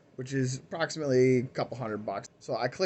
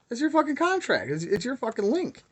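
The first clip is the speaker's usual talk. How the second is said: pitched way up, indignant and disbelieving; indignant outburst; 10 semitones higher than usual. Retold or imitated. Replying to the scammer who blamed his contract, high indignant outburst. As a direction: throw the blame right back at him.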